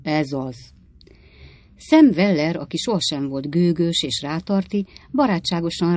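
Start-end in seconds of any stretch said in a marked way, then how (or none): 0.0-0.5 grumbling. A narrator's reading. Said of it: "azt dörmögte".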